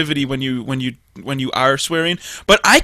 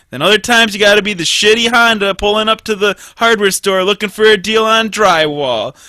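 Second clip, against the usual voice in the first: ordinary talk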